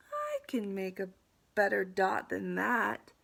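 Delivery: building to a loud, firm finish; determined. Acted. Pupil seeing her framed dot, determined. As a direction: take up the challenge. Pupil challenges herself in story.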